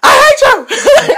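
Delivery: very high, loud, slow wail at the heckler; wounded shout. Retold or imitated. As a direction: shriek it back at the crowd. Retort to heckler.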